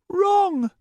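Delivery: insist, not giving an inch; insistent high girl voice. Acted. Starbreeze refuses.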